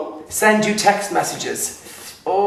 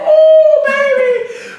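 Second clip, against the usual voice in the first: high, loud excited squeal; 7 dB louder, much slower than usual. Real, not acted. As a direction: squeal it out with delight.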